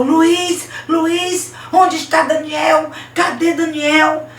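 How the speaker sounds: high, loud confrontation; demanding shout. Acted. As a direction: cry out, demanding an answer. Girl confronting Luiz, demanding answers. (grito de cobrança, agudo e alto)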